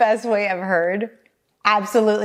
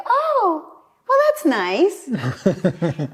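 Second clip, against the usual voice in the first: mock sweet shrug; 7 semitones higher, much slower than usual. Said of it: "people are like".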